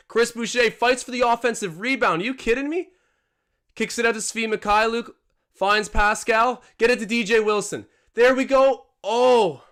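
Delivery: high, loud rising call of the comeback; excited play-by-play. Acted. Streamer calling the Raptors comeback for viewers, excited loud call. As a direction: sell the Raptors rally like a broadcaster.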